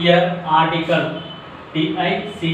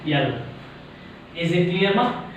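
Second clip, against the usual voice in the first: ordinary talk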